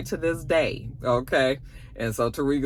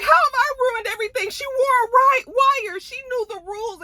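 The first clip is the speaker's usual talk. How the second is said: much higher, fast defensive protest; high mock girlfriend; 3 dB louder than usual. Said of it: "And she was just like".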